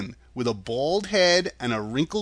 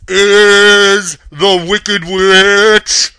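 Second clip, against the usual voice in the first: very loud, slow boom with a slight tremble; huge drawn-out voice, wavering at the end; trembling booming wizard; 7 semitones higher, 15 dB louder, much slower than usual. A narrator's reading. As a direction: thunder it slowly, faltering a little. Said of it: "thought it trembled a little".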